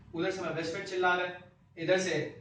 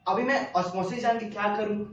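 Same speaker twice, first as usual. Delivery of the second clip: normal talking voice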